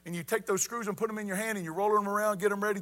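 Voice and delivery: normal talking voice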